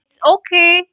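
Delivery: loud, high call to someone far above. A narrator's reading. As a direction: shout it up the shaft. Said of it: "he called up to the light".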